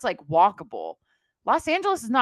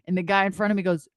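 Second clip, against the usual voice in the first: normal talking voice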